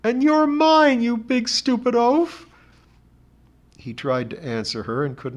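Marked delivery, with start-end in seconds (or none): 0.0-2.5 high, slow, tearful outburst; bursting into tears. A narrator's reading. Betty weeping at John Thomas, high tearful outburst. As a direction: blurt it through rising tears. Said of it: "She burst into tears".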